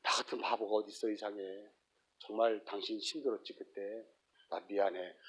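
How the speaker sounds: soft, quiet tearful confession; mildly imitating a husband apologizing through tears; mock tearful apology. Repentant husband apologizing to his wife, softly tearful. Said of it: "눈물을 흘리면서".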